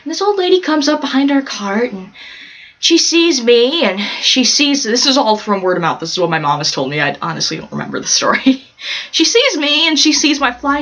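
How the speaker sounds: usual speaking voice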